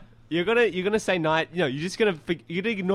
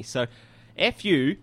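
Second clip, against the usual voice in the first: ordinary talk, nothing marked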